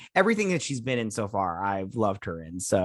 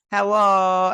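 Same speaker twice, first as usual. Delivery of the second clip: loud, high acted hello; called-out greeting; 7 semitones higher, 8 dB louder than usual. Retelling a character's hello as she catches the sriracha, called out. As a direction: call it out high and drawn out.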